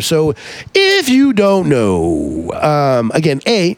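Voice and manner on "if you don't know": very slow, stretched-out lead-in; long elongated vowels, winding into the explanation; drawn out. Real, not acted. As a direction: drag each word out for show.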